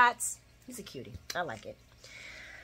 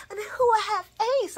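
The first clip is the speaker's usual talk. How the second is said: a hint of a pleading friend, much higher; mock Elizabeth; 12 semitones higher, faster than usual. Elizabeth justifying not testifying, mock pleading. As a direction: whine the excuse. Repeat of mocked excuse.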